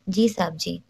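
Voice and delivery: usual speaking voice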